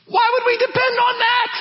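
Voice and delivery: high, rising disbelief; incredulous question. Real, not acted. Preacher to congregation, incredulous and high. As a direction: ask it as if it's absurd.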